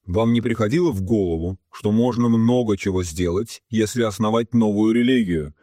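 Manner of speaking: ordinary delivery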